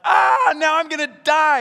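high, loud mock panic; panicked shout. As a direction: yelp it like you're sure you'll die. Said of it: "Gideon's like".

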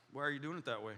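low, soft deep-voiced coworker. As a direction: imitate a calm man with a deep voice. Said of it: "He's got this deep voice".

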